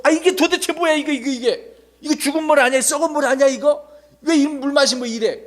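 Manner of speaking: high, indignant protest; acted angry complaint. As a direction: protest in disgust, pitch well up. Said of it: "더 화가 나가지고". (화가 나서 따지는 높은 목소리)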